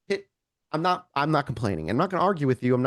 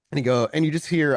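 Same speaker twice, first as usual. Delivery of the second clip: ordinary talk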